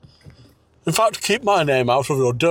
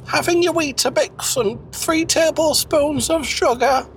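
high character voice; 12 semitones higher than usual. Acted.

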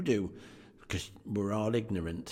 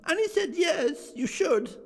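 put-on high, eager child voice; little Fran telling her brother the good news, high and excited; little girl; 13 semitones higher than usual. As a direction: chirp it like an excited little sister. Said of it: "said the child".

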